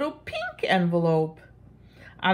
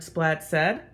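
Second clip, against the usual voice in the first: plain reading voice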